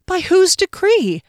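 high, loud demand. A narrator's reading. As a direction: demand it sharply. Fennel demands, stunned.

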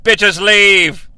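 a hint of a growled movie-villain line; Clarence Boddicker. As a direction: toss it off like a thug. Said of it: "as Clarence Boddicker would say".

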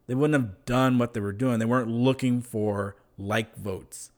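ordinary talk

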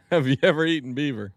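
usual speaking voice

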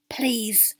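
soft pleading whisper; hushed plea to his colleague. A narrator's reading. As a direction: beg quietly. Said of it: "Queenie heard him whisper".

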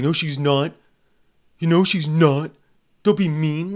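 hammed-up protective mom voice; mock defensive parent. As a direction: play the touchy parent who refuses to hear it. Mocking an over-defensive parent.

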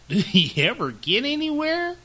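high, loud taunt; mocking hare. A narrator's reading. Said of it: "he asked with a mocking laugh".

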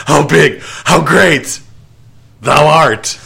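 mock yell. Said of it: "Who yells that?".

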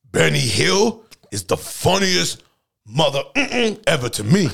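put-on New York accent. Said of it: "I can't even do a sturdy New York accent".

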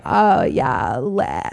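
put-on low, lazy Valley-style California accent; super California. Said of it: "say something super California".

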